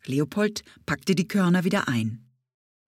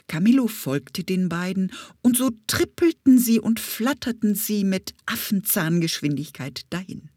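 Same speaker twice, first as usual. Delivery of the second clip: plain reading voice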